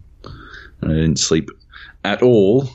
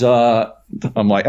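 ordinary delivery

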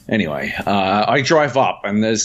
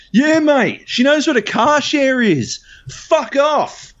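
imitated angry yell; slower than usual. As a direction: yell it like a man warning someone off. Acted angry man confronting the teller.

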